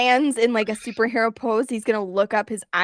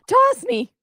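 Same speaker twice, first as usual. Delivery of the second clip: high squeaky voice; 13 semitones higher, 6 dB louder than usual. Acted. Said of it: "kinda go".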